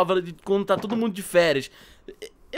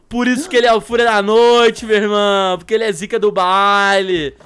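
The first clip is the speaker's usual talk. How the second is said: excited bragging; 8 dB louder than usual. Real, not acted. Excited about his dragon.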